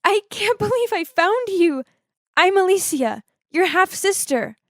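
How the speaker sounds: high-pitched, emotional outburst; high, tearful confession to a long-lost sister. Acted. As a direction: blurt it out high, overcome with emotion. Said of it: "couldn't hold back my tears and said".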